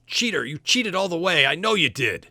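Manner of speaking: high, loud sore-loser rant; outraged accusation. Acted. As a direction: accuse the winner in front of the crowd.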